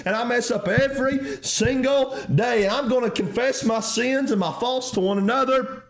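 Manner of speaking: high-pitched, fervent sermon confession; impassioned preaching. Real, not acted. Preacher confessing to the church that all are sinners, impassioned. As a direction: preach it urgently, pitched well up. Preacher returns to full force.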